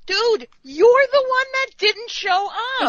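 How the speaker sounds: high-pitched exasperated scolding; indignant retort. Acted. Fan telling Riker he didn't show up, indignant. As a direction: call him out, incredulous.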